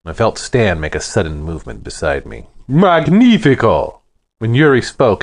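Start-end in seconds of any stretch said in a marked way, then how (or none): 2.7-4.0 big, high, booming Russian voice, drawn out; booming Russian accent. A narrator's reading. Yuri bursting in and greeting Stan, booming Russian accent. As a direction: boom it out in a thick Russian accent. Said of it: "his voice boomed like a backfiring car with a Russian accent".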